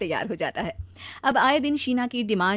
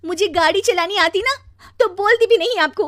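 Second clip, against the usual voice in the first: loud, high-pitched wifely scolding; angry retort; 11 semitones higher, 7 dB louder than usual. Acted. Wife scolding husband who complains of driving, loud and angry. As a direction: hurl it back at him, shrilly exasperated. Wife snaps back in quarrel.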